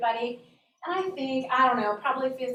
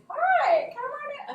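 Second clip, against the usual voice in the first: very high, singsong greeting; super excited welcome; 5 dB louder, slower than usual. Retold or imitated. Principal welcomes nervous child into office, super excited. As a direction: chirp it as an eager principal. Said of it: "he's super excited to see me".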